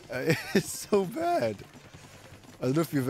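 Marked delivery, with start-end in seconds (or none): 0.1-1.6 high, drawn-out words through a laugh. Real, not acted. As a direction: laugh while saying it, pitched up and slow. Laughs recalling bad taffy flavor.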